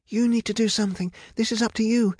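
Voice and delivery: high-pitched, urgent insistence; protesting. A narrator's reading. Rapunzel demands Medea fix the mess, urgently protesting. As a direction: throw the blame back and demand action. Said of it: "protested Rapunzel".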